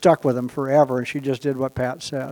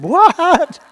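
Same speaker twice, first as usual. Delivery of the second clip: a sudden, much higher, louder "What?"; shocked what; 10 dB louder than usual. Retold or imitated. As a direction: jump up in pitch with disbelief. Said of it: "their eyebrows find new real estate on their forehead going".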